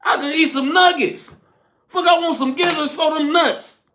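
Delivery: retelling how he yelled no at the gizzards; retold refusal. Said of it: "I said".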